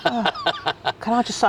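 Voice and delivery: normal talking voice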